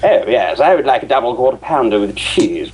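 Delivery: hammed-up posh English "I would like a double quarter pounder with cheese"; posh English order. The British fan turns the order into a mock posh English gent. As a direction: order it like an aristocrat with a monocle. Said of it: "remove my monocle and tilt my top hat".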